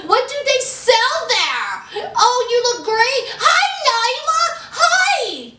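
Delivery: high, bubbly gushing greeting. Retold or imitated. Friends gushing over Nyla and LA stores, peppy mock voice. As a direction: mimic over-perky women squealing hellos and oh-my-gods.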